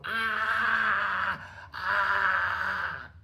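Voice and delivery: long high mock wails, held back in volume; re-enacted high, drawn-out holler. Inmate already in the hole keeps disrupting, hollering. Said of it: "I kept hollering".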